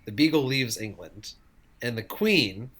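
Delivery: ordinary talk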